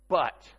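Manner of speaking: one loud, weighty "But", then a pause; emphatic "But". Acted. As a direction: punch "But" to turn the argument. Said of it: "Look what he says".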